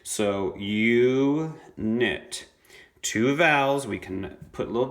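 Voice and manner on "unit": "unit" as two beats, you, nit; split into syllables. Shown as a demonstration. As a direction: hold the U of "unit" apart from nit. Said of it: "that vowel's just by itself".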